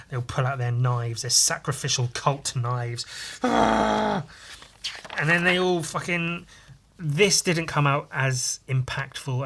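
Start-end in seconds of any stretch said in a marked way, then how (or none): 3.4-4.3 high drawn-out "aah" yell; acted battle cry. Cultists pulling out knives, high mock scream. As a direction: imitate a charging mob's cry. Imitates knife-wielding cultists attacking.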